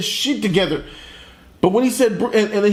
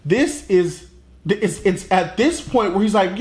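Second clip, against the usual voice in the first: normal talking voice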